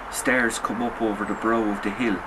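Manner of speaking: ordinary delivery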